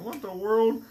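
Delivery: high, loud disbelief; shocked. Retold or imitated. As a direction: blurt it out in alarm. Terrified on cliff edge.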